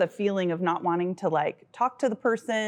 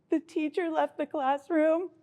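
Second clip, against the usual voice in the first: high, halting mock-sobbing confession; imitated tearful child; 8 semitones higher than usual. Mother mimics her daughter's confession, high and mock-tearful. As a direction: imitate a child confessing through tears, in bursts. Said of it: "just started crying one day".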